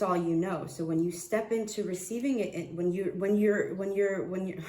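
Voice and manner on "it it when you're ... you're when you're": tripping over the same words; stuttering. Real, not acted. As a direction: get stuck restarting the phrase, then give up. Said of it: "I'm stuttering".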